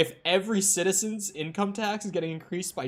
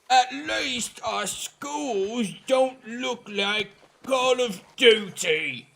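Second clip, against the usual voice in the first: put-on slow, drawn-out, higher posh British accent; exaggerated British accent; 8 semitones higher, much slower than usual. Mocking an American with a slow, exaggerated British accent. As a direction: ham up a British accent, stretching every word. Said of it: "I love the highly exaggerated British accent".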